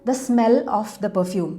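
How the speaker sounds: very slow, careful dictation pace; slow model sentence. Shown as a demonstration. Teacher modelling for Malayalam viewers, very slow and clear. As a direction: spell it out word by word for learners. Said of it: "നമ്മളെങ്ങനെയാ പറയാ".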